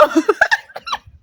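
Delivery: very high squealing laugh; high embarrassed squeal of laughter; shrieking laugh. Real, not acted. Embarrassed at her own thought.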